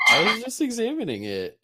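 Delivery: high, loud guilty protest; defensive. Acted. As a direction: insist innocently, a bit too loud. Defensive in-character denial.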